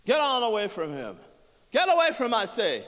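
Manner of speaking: loud, high command; shouted order. Acted. Driving everyone away from the body, shouted command. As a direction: order them off at full voice.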